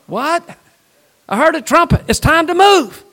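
high, loud, startled cry of realization; a believer, startled, hearing the last trumpet and ready to go; excited believer. Acted. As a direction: jolt awake at the trumpet blast.

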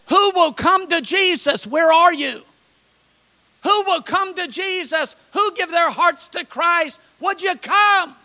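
high-pitched preacher calling to a crowd; evangelist's appeal. Retold or imitated. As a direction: call out the invitation high, without extra volume. Acts evangelist's altar call.

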